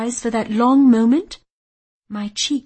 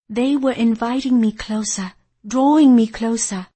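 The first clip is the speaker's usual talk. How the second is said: usual speaking voice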